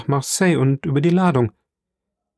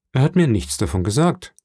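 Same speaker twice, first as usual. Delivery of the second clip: usual speaking voice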